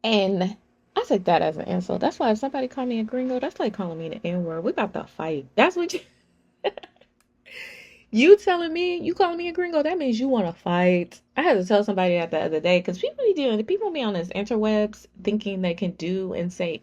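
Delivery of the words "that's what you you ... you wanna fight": playful fighting talk. Real, not acted. Joking threat to fight.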